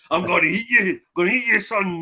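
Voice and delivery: playful threat, half laughing; drunk uncle voice. Retold or imitated. Uncle mock-threatening a little kid, loud and playful. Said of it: "drunk uncle around children".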